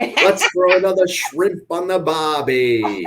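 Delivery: loud, high, drawn-out Aussie accent; exaggerated Australian accent, stretched out. Shown as a demonstration. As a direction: ham it up as a slow Australian holler. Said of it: "in an Australian accent".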